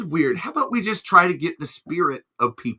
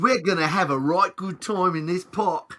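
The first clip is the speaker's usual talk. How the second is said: put-on Cockney accent; 4 semitones higher than usual. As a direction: ham up a movie Cockney. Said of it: "Mary Poppins".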